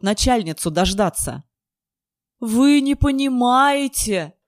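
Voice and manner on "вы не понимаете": slow, high desperate shout; shouting into the phone. A narrator's reading. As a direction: yell it into the receiver. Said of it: "прокричал ... в трубку".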